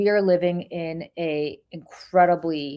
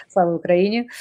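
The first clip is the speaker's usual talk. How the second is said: Russian accent; 5 dB louder than usual. Shown as a demonstration. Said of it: "I say that with my Russian accent".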